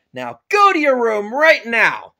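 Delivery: loud, high dad command; angry order. Acted. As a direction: yell it to end the argument. Father sends son to room.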